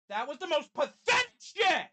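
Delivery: real outraged yell, high and loud; furious shout. Real, not acted. Fan reacting to a lost game, furious shout. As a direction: explode at the team.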